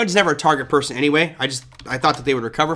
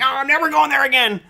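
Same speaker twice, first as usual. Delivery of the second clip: mock customer voice; 10 semitones higher than usual. Customers quitting Target, voiced.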